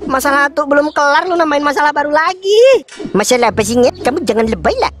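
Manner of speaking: ordinary talk, nothing marked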